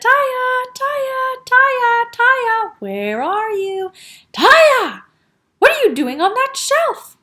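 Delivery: high, loud searching call. A narrator's reading. Father looking for his daughter, calling out. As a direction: call out for a lost child, then exclaim.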